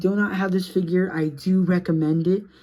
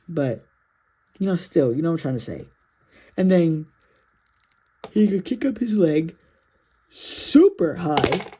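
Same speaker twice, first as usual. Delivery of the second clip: normal talking voice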